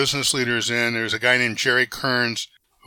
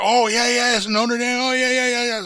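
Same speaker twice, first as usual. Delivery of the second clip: much higher, eager receptionist voice; chirpy secretary; 11 semitones higher than usual. Retold or imitated. Secretary answering the banker's phone, cheerfully high-pitched. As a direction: play the secretary gushing with instant recognition. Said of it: "and his secretary".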